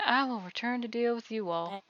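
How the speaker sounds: normal talking voice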